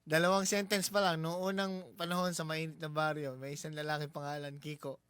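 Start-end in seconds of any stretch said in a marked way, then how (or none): none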